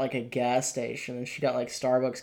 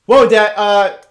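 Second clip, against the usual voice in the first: high, loud, fast taunt; bratty son; 7 semitones higher, 13 dB louder than usual. Retold or imitated. Son taunting his cop father, loud and fast. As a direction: yell it like a cheeky son.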